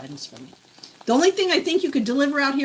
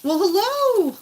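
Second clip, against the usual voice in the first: higher, louder sing-song hello; pet greeting; 9 semitones higher, 5 dB louder, slower than usual. Real, not acted.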